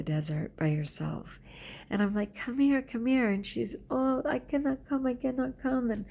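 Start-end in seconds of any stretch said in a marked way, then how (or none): 2.3-3.3 high, coaxing call; imitated beckoning call. Teacher beckoning a scared girl to her, imitated calling. 3.9-5.9 high-pitched, pleading mimicry of a scared girl; imitated tearful fright. Crying girl refusing to come to the teacher, imitated panic. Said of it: "She's crying her head off".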